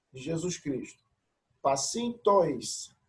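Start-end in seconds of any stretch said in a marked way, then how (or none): 1.6-3.0 Pacintois said slowly, each syllable clear; slow syllable reading. Shown as a demonstration. Teacher sounding out a Greek word slowly. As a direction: read Pacintois slowly for the class. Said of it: "a preocupação aqui é a pronúncia".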